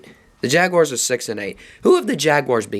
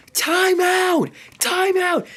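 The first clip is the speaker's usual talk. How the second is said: shouting from stands; 14 semitones higher, much slower than usual. Retold or imitated. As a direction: yell it across a stadium. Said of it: "I was screaming from the stands, shouting".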